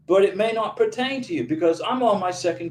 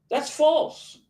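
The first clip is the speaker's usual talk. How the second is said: indignant; 7 semitones higher than usual. Real, not acted. Indignant at the US government.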